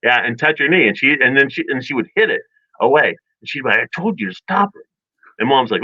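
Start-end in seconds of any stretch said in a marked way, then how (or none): 3.8-4.9 mock exasperated snap, higher and softer; annoyed older sister. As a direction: imitate a fed-up sister, pitched up.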